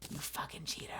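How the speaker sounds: hissed whisper insult. Real, not acted. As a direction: whisper it sharply.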